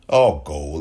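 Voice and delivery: mocking. Mocking repeat of statement.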